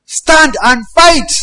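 high, loud rallying cry, slow on fight; commanders shouting. Acted. Commanders rally fleeing men, loud high shout. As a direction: bellow it over the rout. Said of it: "many of the commanders are saying".

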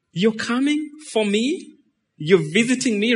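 mock astonishment, rising at the end; acted disbelief. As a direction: ask as if you can hardly believe it. Acts out surprise at Jesus visiting.

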